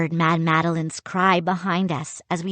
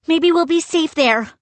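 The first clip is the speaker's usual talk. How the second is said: calling out; 8 semitones higher, 4 dB louder than usual. A narrator's reading. Boy calling while fleeing.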